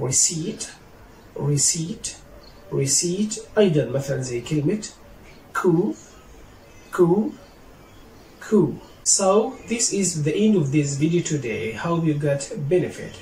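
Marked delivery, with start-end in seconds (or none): 0.1-3.3 "receipt" as "re-seet", rising; teacher's careful "receipt", straight to the t; silent P. Shown as a demonstration. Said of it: "I have some words for silent letter P". 5.5-8.7 "coup" as "koo", no p sound; teacher's lifted "coup", P left silent. Shown as a demonstration. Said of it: "I have some words for silent letter P".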